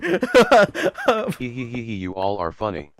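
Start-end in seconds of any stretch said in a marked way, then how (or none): 0.0-1.8 stiff robotic "hee hee hee"; weird bot laugh. Acted. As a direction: spell out the laugh like a machine. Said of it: "my bot laugh is weird".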